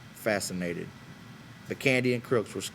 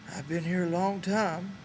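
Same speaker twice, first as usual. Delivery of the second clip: put-on higher, slower old swamper, hesitant and embarrassed; a shy old ranch hand easing into small talk; Candy's old man voice; 9 semitones higher than usual. A narrator's reading.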